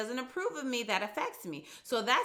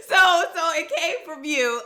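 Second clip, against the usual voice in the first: louder and higher, through laughter; laughing; 8 semitones higher, 11 dB louder than usual. Real, not acted. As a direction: say it still laughing. Still laughing at the joke.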